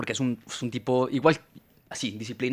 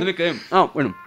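talking with a mouth full; words round a bite of food; 5 semitones higher than usual. Real, not acted. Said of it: "para no hablar con la boca llena".

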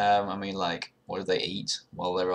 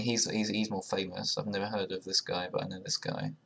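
usual speaking voice

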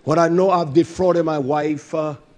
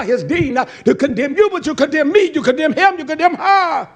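building to a high, forceful rebuke; fiery sermon delivery; impassioned preaching; 8 semitones higher, 5 dB louder than usual. Real, not acted. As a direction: preach hard, climbing as you accuse.

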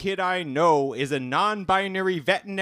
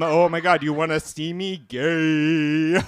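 ordinary talk, nothing marked

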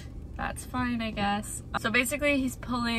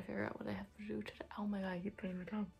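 whispering; 16 dB quieter, much faster than usual. Real, not acted. Whispered complaint.

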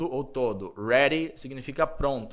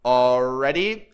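slow model word; 6 dB louder, much slower than usual. Shown as a demonstration. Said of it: "teacher models the word".

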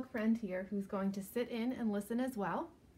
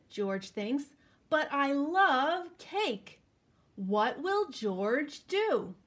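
put-on slow, lively storybook reading for kids; reading to children; 2 semitones higher, 5 dB louder, slower than usual. A narrator's reading. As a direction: read it out like story time for little ones. Reading picture book to children.

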